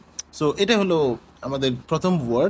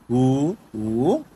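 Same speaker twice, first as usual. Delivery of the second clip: উ rising, then উ dipping and rising; second, third tones. Shown as a demonstration. Teacher running the same tone drill, rising then dipping উ. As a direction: swing each উ upward, the second from a dip. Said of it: "এই নিচের ক্ষেত্রেও same হচ্ছে".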